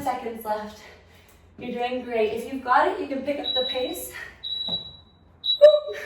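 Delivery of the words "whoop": sudden high whoop as balance slips; startled whoop. Real, not acted. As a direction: yelp as you nearly topple. Nearly losing balance mid-exercise.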